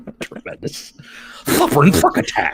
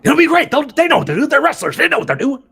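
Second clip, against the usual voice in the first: higher, pushy reassurance; mock eager insistence; 10 semitones higher, faster than usual. Booker defending the truck idea, higher and pushy. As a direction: insist it'll all work out.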